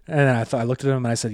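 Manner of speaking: ordinary talk, nothing marked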